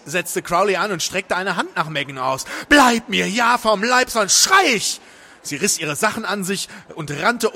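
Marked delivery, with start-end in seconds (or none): 2.7-5.0 high, loud threat; panicked warning. A narrator's reading. Woman backing away from Crowley, loud panicked warning. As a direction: warn him off forcefully.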